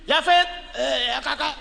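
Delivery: hammed-up angry older sibling; mock angry threat. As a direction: threaten the late sleeper, half joking. Acted angry threat to sleeper.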